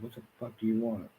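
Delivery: retold angry yell. Retold clash with yelling neighbors, angry mock yell. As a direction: throw it back at them, half-yelled.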